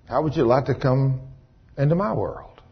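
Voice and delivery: low, soft voice of God; hushed, tender divine offer; gentle invitation. Retold or imitated. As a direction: invite him in quietly.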